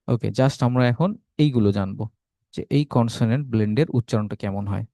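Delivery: normal talking voice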